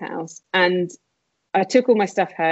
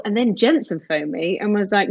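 ordinary talk, nothing marked

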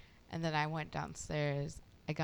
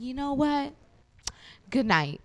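loud mock-angry sign-off, pitched up then dropping; retold pissed off; 8 semitones higher, 5 dB louder than usual. Storyteller replays brushing off the neighbor, loud and pissed off. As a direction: cut off the conversation in retold annoyance. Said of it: "was pissing me the fuck off".

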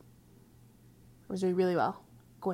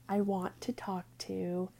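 soft confiding whisper; 9 dB quieter, slower than usual. Real, not acted. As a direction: whisper it sincerely. Confession, whispered.

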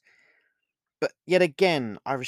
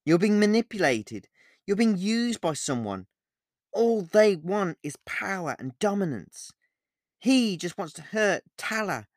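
loud appeal climbing to a higher pitch; urgent reasoning; 6 semitones higher, 6 dB louder than usual. A narrator's reading. Olivia tries to make Alex let her go, urgently persuading. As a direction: press him hard to see reason. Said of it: "trying to make Alex see reason and let me go".

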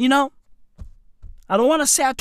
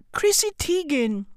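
normal talking voice

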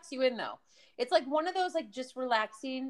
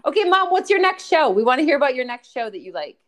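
loud, emphatic prompt to Mom; calling out; 11 dB louder than usual. Real, not acted. Host asking Mom for her next show, loudly. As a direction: speak up firmly to move things along.

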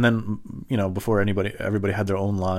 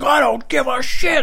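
high, loud, slow shout; mock shout; 7 dB louder, slower than usual. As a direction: bellow it back in mock rage. Driver shouting.

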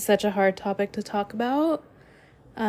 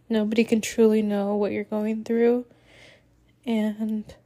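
usual speaking voice

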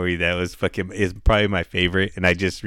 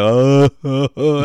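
imitated slow-motion laugh, pitched up; 5 semitones higher, 5 dB louder, slower than usual. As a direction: laugh in drawn-out 'oh, oh, oh' like slow motion. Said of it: "it was, like, slow laugh".

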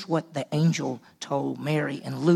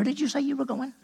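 incredulous question; 9 semitones higher, 4 dB quieter, faster than usual. Acted. Joseph confronting pregnant Mary.

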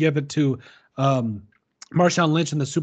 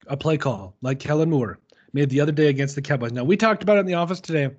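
ordinary talk, nothing marked

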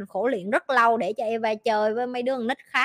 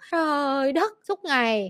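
ordinary talk

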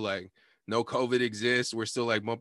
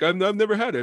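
higher, louder retold eagerness; excited; 8 semitones higher, 6 dB louder, faster than usual. Driver chatted up about the pizza spot, excited. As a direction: burst in eagerly, imitating the driver. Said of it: "this guy was, like, excited about the pizza".

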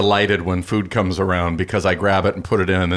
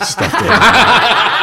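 loud high laughing; cracking up mid-sentence; 14 semitones higher, 8 dB louder than usual. Real, not acted. Laughing at the story.

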